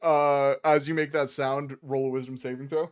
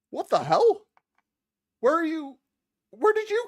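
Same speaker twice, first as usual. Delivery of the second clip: higher, louder startled call; mock surprised shout; 5 dB louder than usual. McGulnar shouts in mock surprise at the old timer, much higher. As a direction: act startled to see him.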